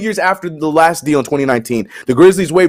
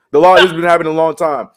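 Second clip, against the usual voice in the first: ordinary talk, nothing marked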